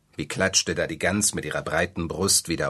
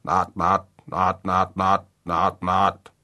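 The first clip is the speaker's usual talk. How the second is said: louder croaking frog calls, warning; a tree frog croaks a warning at the birds, loud; 4 dB louder, much slower than usual. A narrator's reading.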